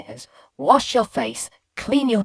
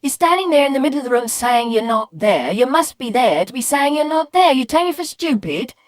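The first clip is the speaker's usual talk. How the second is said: incredulous parent losing patience; exasperated mum scolding; 8 semitones higher, 4 dB louder than usual. Acted. Mum to son claiming he's not there, exasperated scolding.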